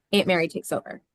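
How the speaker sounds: normal talking voice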